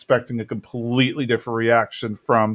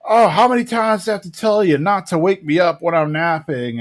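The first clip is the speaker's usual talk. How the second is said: higher-pitched put-on irritated character; grumpy manager; 4 semitones higher than usual. Manager woken from his nap, put-on grumpy voice. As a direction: complain like someone just woken. Napping manager's annoyed complaint acted.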